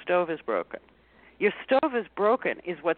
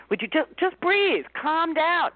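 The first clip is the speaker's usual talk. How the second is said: mock helpful advice; 9 semitones higher, 3 dB louder than usual. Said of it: "somebody really helpfully says".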